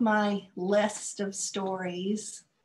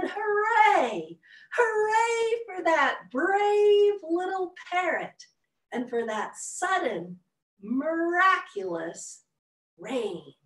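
high joyful cheer; 10 semitones higher, 5 dB louder, slower than usual. Acted. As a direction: shout hooray with delight. Characters cheering in story.

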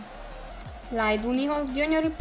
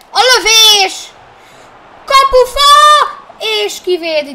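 excited Hungarian sports commentary; 8 semitones higher, 12 dB louder than usual. Pretend. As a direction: call the shot like a live commentator. Shot hits post, commentary.

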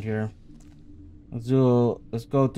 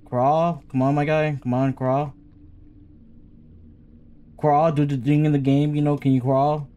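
urgent pleading; 4 semitones higher, 5 dB louder than usual. Real, not acted. Pleading with game character.

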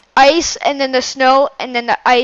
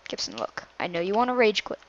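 whisper; 2 semitones lower, 9 dB quieter than usual. Real, not acted. Hushed plea before rage quit.

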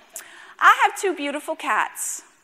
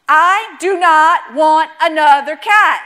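firm insistence; 4 semitones higher, 10 dB louder, much slower than usual. Retold or imitated. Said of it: "He said".

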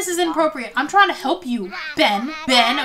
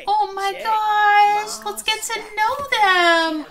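high gasp into drawn-out cooing; gushing; 7 semitones higher, slower than usual. Real, not acted. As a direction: coo it high and slow. Gushing over the little children.

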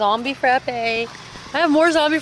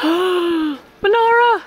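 startled gasp; 8 semitones higher, 4 dB louder, much slower than usual. Real, not acted. Gasps at a scary costume.

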